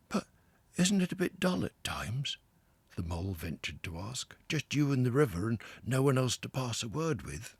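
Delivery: a newcomer seeing the river for the first time; Mole, awed and a little breathless; wide-eyed Mole. A narrator's reading. Mole timidly asking.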